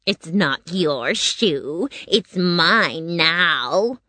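very slow, drawn-out witch voice; gloat, stretching every word; gloating wicked witch. A narrator's reading. Witch keeping Dorothy's stolen shoe, slow gloating. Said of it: "the wicked witch replied".

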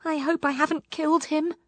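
high-pitched, worried plea; hope against hope, pitched high; anxious praying. A narrator's reading. Poppy fears she killed the frog, anxious praying. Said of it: "she prayed".

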